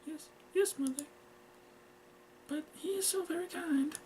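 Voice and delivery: put-on high, soft girlish voice; meek daughter. Girl asks to speak to her mother, meek. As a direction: plead meekly, small and high.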